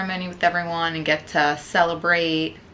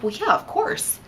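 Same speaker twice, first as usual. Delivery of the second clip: surprised; 13 semitones higher than usual. Retold or imitated. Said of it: "I said".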